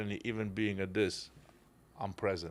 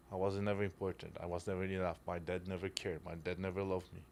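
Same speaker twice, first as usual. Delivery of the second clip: quiet hurt voice; 3 semitones lower, 7 dB quieter than usual. Acted. Voicing the hurt son's inner thoughts.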